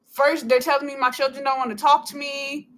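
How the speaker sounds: normal talking voice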